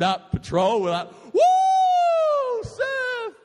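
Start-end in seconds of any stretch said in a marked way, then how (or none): 1.3-3.3 very high, loud whoop; whooping cheer. Retold or imitated. Two guys out of a steamed-up car, whooping loud. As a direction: let out a wild surf whoop.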